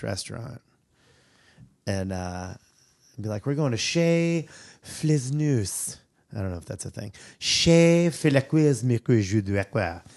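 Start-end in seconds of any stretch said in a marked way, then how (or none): 3.5-6.0 slow, hammed-up mock French accent. Fake fancy French restaurant name. 7.4-10.0 slow, hammed-up mock French accent. Fake fancy French restaurant name.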